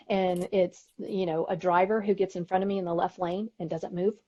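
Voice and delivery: ordinary talk, nothing marked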